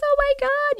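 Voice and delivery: high, shocked oh my God; shocked squeal. Real, not acted. Streamer reacting to chat's theory, high shocked exclamation. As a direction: let it fly up in shock.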